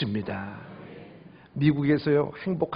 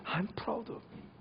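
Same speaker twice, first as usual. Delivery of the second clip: soft comfort; 15 dB quieter, much slower than usual. Retold or imitated. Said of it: "전화로 외국에서 눈물로 할 때마다".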